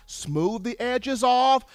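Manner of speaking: normal talking voice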